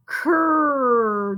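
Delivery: stretched "Cur" blend; slow blend sound "Cur". Shown as a demonstration. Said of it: "Did you guys hear that C R?".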